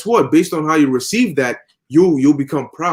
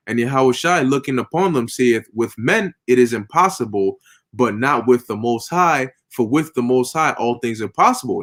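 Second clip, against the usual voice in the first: scripture reading; 4 semitones lower than usual. Acted. Reads scripture aloud.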